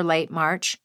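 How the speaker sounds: ordinary talk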